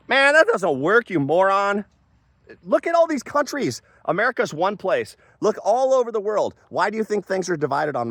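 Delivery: high-pitched, exasperated outburst; exasperated rant. Real, not acted. Ranting at an imagined opponent about countries, exasperated. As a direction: argue it heatedly, pitch jumping way up.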